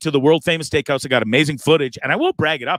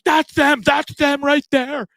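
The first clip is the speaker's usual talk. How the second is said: mocking the manager; 11 semitones higher, slower than usual. Manager points them out to cop.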